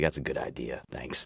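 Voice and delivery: low, soft, resigned reply; subdued agreement. Retold or imitated. Patient to desk lady after her sales pitch, subdued agreement. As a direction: concede quietly, voice dropped right down. Reenacts meekly giving in at desk.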